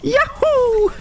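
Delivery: very high-pitched cartoon cheer; Mario. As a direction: yelp it high like a game hero. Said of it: "I'm Mario".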